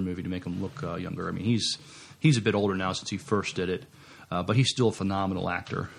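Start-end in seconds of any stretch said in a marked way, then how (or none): none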